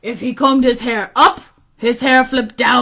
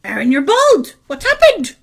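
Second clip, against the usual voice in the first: high-pitched, swooping motherly surprise; alarmed mother; 6 semitones higher than usual. Acted. Mother seeing her son bald, alarmed. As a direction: exclaim it high, taken aback. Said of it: "his mother said".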